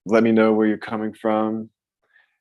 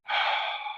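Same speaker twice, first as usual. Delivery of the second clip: demo sigh; 8 dB quieter than usual. Shown as a demonstration. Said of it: "with a little bit of a ha or an ah sound".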